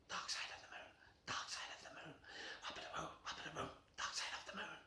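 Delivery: eerie whispered chant. Acted. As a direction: breathe the words like a spooky secret. Eerie chant about dark moon.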